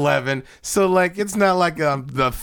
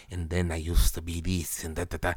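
low mumbled storyteller; 8 semitones lower, 8 dB quieter than usual. Retold or imitated. Retelling a movie character's story, low and murmured. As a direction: drop low and quiet, murmured.

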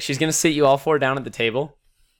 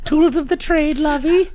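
put-on high-pitched, loud old fortune teller; pitched-up fortune-teller banter; 6 dB louder, slower than usual. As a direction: chirp it up high like a doting crone. Game master voicing fortune teller.

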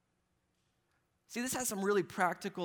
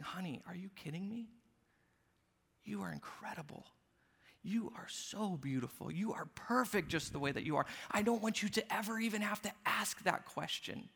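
soft, much quieter loving reassurance; tender father; 4 dB quieter than usual. Retold or imitated. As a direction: comfort a doubting daughter gently. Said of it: "her to hear my voice saying".

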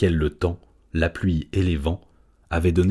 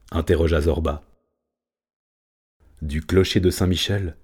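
usual speaking voice

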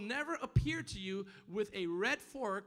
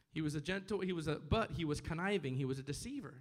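ordinary talk

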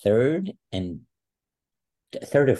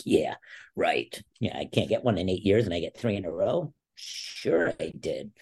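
sarcastic disbelief. Retold or imitated. Wrestler rejecting coach's false praise, retold sarcastic disbelief. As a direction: scoff at the praise, stretching the last words.

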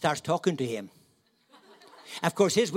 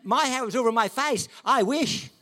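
higher, louder laughing comeback; mock retort; 8 semitones higher, 6 dB louder than usual. Answering his wife, a laughing mock retort. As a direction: toss it back high and loud, laughing. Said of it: "And I said".

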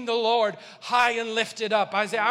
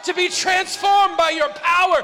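high, loud impassioned preaching; preaching at full force; 8 semitones higher, 8 dB louder than usual. Real, not acted. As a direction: preach it full throttle to the church.

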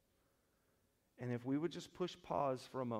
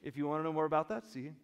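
ordinary talk, nothing marked

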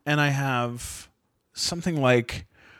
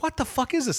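retold angry disbelief; 11 semitones higher, faster than usual. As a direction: relive baffled anger at a higher pitch. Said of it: "I'm so angry".

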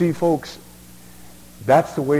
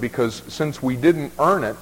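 usual speaking voice

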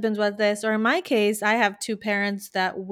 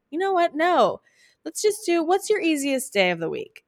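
easygoing coach; 8 semitones higher than usual. Retold or imitated. Coach acting easygoing reply to client.

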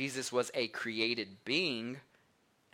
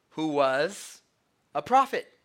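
mock condescension; 3 semitones higher, 5 dB louder, slower than usual. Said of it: "So they said to him".

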